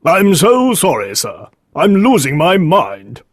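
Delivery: high, loud, desperate apology; distressed cry. Acted. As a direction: cry out as a man at the end of his tether. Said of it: "Green cried".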